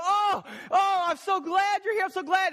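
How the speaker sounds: high-pitched, gushing delight; overexcited dog. Acted. Dog greeting its owner coming home, overexcited. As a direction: gush like a dog all over its owner. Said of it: "your dog is all over you".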